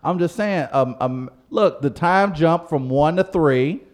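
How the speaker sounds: high, loud defensive protest; indignant protest. Real, not acted. Host defends his club nap to teasing co-hosts, indignant.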